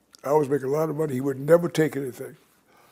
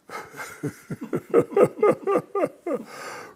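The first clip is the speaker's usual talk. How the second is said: airy, high laugh with no voice; breathy high laugh. Real, not acted. Laughs at himself after thanking mom. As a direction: laugh it off, almost silent. Laughing at his own joke.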